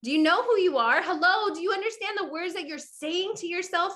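loud, high, exasperated wake-up call; exasperated shout. Retold or imitated. Shaking a self-critical person awake, loud exasperated shout. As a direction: shout at them to snap out of it. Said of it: "I just wanna shake that person and be like".

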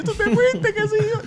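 very high mock sobbing whine; fake crying. He whines to his girlfriend again, very high mock sobbing. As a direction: whimper it like a crybaby. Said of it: "Empecé a llorar".

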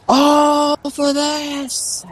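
high-pitched drawn-out cry; battle cry. Acted. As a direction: cry it out high and long, as a charging fighter. Robed men's battle cry.

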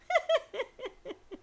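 sudden high-pitched laugh. Real, not acted. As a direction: burst out laughing. Laughs at Victor.

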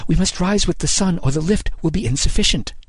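high, fussy insistence; urgent scholar. A narrator's reading. Sexton urges haste to Kidd.

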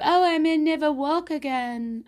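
high, hammed-up self-pity; melodramatic. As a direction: wail it like a tragic heroine. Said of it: "wallowing in my dramaticness".

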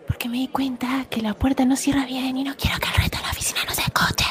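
fast secretive whisper. Acted. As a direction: whisper so the office can't hear. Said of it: "¿Por qué estamos hablando en voz baja?". (susurro rápido y secreto)

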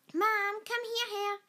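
high, loud call. A narrator's reading. Young wolf wants his mother to come, calling out. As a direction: call over to someone at a distance. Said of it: "rief er".

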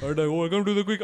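mock caricature shopkeeper greeting; mock Apu accent. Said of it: "talking like a brown guy".

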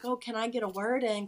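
ordinary delivery

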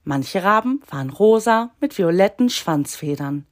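usual speaking voice

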